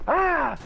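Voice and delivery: high playful scream, echoing; a toddler's happy shriek, retold; imitated toddler scream. Dad imitating his daughter's slide scream, high playful shriek. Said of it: "she'd look at me and go".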